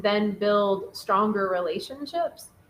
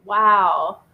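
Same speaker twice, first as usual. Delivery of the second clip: usual speaking voice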